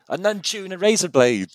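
loud, high-pitched character quote; imitated shouted punchline. Fan re-enacting her joke answer to him, shouted.

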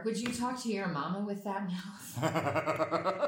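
slow, high, teasing mama scold; mock scolding mom. Mocking a mother scolding her child, slow and high. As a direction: wag a finger like a scolding mother.

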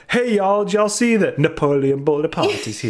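exaggerated y'all-style Southern accent; mock Southern American accent. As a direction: imitate a folksy Southern American, laughing. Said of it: "he essentially says stuff like".

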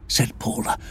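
plain reading voice

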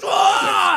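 loud high scream of pain; imitated pain scream. Drunk man with a finger in his cut, imitated scream. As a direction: mimic him screaming out loud.

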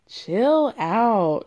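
ordinary delivery

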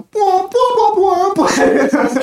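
high loud blaring bwah sounds; imitated intro jingle. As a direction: belt out the intro sting. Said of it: "Ali A intro".

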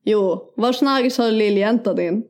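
slow, broad Norrland bondska; Norrland accent. Acted. Showing off bondska to co-host, Norrland accent. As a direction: lay on thick northern Swedish dialect. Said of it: "Nästa är norrländska".

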